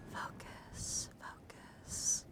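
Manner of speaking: drawn-out, voiceless whisper; whispered command. Pretend. Streamer coaxing the camera to focus, whispered. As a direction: whisper it softly like a hypnotist's cue.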